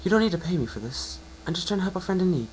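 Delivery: high, bright-voiced mare, encouraging; a kind unicorn reassuring her patient; Twilight's voice.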